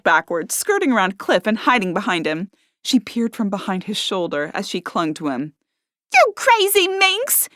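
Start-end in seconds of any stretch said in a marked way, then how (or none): 6.1-7.5 much higher, slow shrill shout. A narrator's reading. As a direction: shout at the attacker. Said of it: "she shouted".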